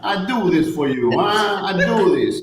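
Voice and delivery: mock Italian accent. Italian playing along with the pepper routine, theatrical accent. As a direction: plead theatrically in a put-on Italian accent, half laughing. Joins in mimicking an Italian waiter.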